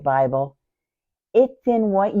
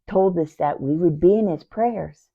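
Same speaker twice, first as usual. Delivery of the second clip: ordinary talk, nothing marked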